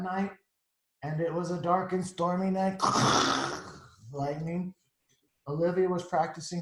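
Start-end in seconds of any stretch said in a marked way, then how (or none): 2.8-3.8 rough mouth-made thunder rumble; imitated thunder crash. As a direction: make a crackling storm noise. Imitates crash of stormy night.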